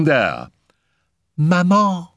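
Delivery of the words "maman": put-on high little child's call; childish voice. A narrator's reading. Spirit child calls to her mother, childish voice. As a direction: pitch it right up like a small child. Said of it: "the soft, childish voice spoke".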